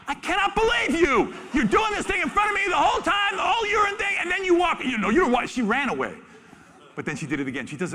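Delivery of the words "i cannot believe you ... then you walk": high, loud indignant complaint; mock outraged tiger. Male tiger rebuffed by the female, mock outrage. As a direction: rant at her like a jilted lover. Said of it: "you could read his face like".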